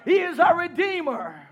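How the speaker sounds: ordinary delivery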